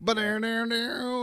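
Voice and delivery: high sung ba-dow riff; hummed theme riff. Retold or imitated. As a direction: sing the intro jingle. Imitating theme music.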